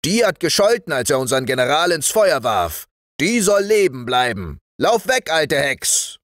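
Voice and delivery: high, forceful sergeant's voice; sergeant ruling. Acted. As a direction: pronounce the verdict, then send her off. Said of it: "sagte der Wachtmeister".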